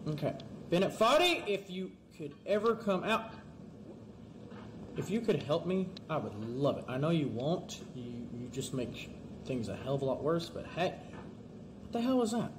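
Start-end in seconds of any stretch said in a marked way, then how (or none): none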